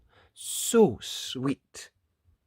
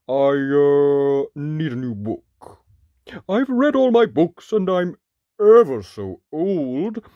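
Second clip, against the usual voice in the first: loud, slow giant speaking politely; a big old giant asking for a book; giant's voice; 4 semitones higher, 10 dB louder than usual. Acted. Giant character voice.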